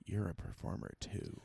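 hushed, secretive whisper. Retold or imitated. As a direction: lean in and whisper it. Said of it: "and was like".

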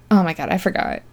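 normal talking voice

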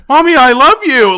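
high, loud mock child's shout; imitated kid yelling. Moviegoer imitating kid mid-movie, high loud call to mommy. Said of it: "Stop yelling, I love you so loud".

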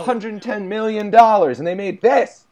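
high, loud incredulous outburst; loud disbelief. Pretend. Outraged over the movie's budget, loud mock disbelief. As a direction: exclaim in hammed-up outrage.

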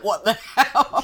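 laughing mock disbelief as the stepdad; a hint of a baffled Scottish stepdad, laughing; imitating stepdad. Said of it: "He would be shaking his head like".

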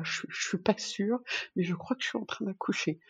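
soft, tearful, unsure voice; quietly on the verge of crying; near tears. Retold or imitated. Mother in labour admitting it to the nurse, softly tearful.

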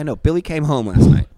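usual speaking voice

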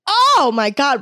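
loud, high cringing cry; real mortified wail; mortified outburst. Real, not acted. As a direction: burst out in embarrassment at yourself. Cringing at her own drunken confession.

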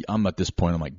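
ordinary delivery

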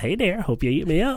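high-pitched flirty nut character; sexy pistachio voice. Acted. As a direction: coo like a pistachio asking to be eaten. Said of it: "Tom's sexy pistachio voice".

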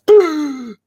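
sharp high gasp back to life; revival gasp. Retold or imitated. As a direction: gasp like coming back from the dead. Revived, he gasps.

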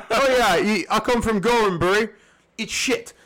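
lightly put-on British voice; deadpan local with no civic pride; British accent. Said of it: "So they're like".

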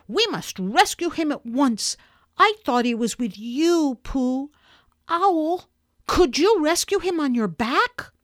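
put-on a boy pressing the others to act now; urgent Christopher Robin. A narrator's reading.

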